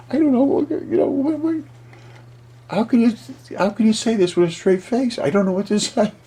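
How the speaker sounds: high, laughing near-squeal at his own story; can't keep a straight face, voice jumps way up; giggly disbelief. Real, not acted. Narrator cracking up at his own dragon theory, high giggly disbelief.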